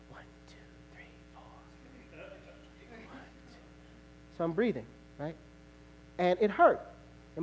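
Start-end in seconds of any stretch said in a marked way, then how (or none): 0.1-3.6 breathy whispered count through pain. Retold or imitated. While paramedics set his ankle, whispered counting. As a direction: whisper the numbers to get through it. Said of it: "And I was like".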